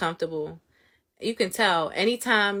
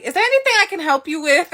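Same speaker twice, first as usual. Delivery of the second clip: loud, high, quick challenge; really stern; 9 semitones higher, 9 dB louder, faster than usual. Retold or imitated. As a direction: confront the customer briskly and forcefully. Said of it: "I'm being really stern".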